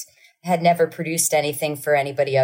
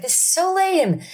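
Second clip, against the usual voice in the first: much higher whiny repeat; whiny kid; 14 semitones higher than usual. Retold or imitated. Mimicking little kid whining at concert, much higher.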